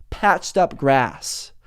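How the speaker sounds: ordinary talk, nothing marked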